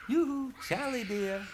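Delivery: high, sweet mermaid call; pretty little voice. A narrator's reading. Mermaid calls to Charlie from the dark, high sweet coo. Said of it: "a pretty little voice says".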